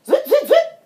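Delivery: high, rapid-fire zipping sounds; squeaky flying-popcorn noise; popcorn whizzing. A narrator's reading. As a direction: flick out high little whizzes.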